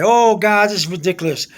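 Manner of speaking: normal talking voice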